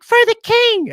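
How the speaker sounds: loud, very high falsetto knight; high-pitched battle cry. Acted. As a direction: cry out like a womanlike-voiced knight. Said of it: "He's got a really high-pitched voice".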